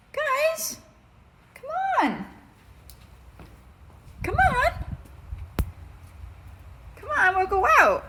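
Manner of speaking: high-pitched, pleading pet-talk; coaxing pets. Real, not acted. Owner coaxing the dogs out the door, high-pitched. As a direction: sing it out high to coax them.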